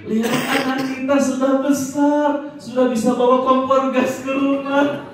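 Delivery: high, slow, delighted praise; doting mother. Pretend. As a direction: marvel sweetly at the child's mischief. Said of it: "ekspresinya seperti ini". (ibu memuji anak dengan manja dan bernada tinggi)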